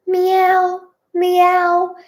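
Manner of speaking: high, drawn-out imitation meow; calling meow. A narrator's reading. Joe searches for the cats, calling in meows. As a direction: call the cats by meowing. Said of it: "Joe calls".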